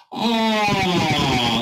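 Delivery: teasing imitation of a bored teen; bored teen sigh. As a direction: play a bored teenager, a little facetious. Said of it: "like they're bored".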